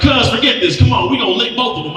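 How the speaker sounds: rally your cousin for a brawl; next-level tough talk. Retold or imitated. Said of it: "took it to the next level".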